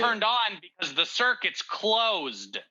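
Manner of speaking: high, nasal know-it-all explanation; nasally voice. Acted. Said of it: "Nasally voice".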